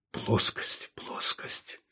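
soft, low-energy mutter to himself. A narrator's reading. Saul muttering to himself after a pause, muttered very softly. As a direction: mutter it under your breath. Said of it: "пробормотал он".

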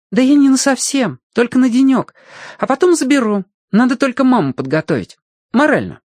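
high-pitched lad reassuring; eager young voice, pitched well up; persuading boy. A narrator's reading. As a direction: talk him round in a high boy's voice. Boy reassures the yard keeper.

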